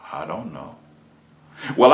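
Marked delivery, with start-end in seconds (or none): 0.1-0.8 small, much softer, lower mumble; standing naked before his Maker, a tiny ashamed answer; sheepish answer. Retold or imitated. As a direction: mumble it like a caught child. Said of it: "the only thing I can say is".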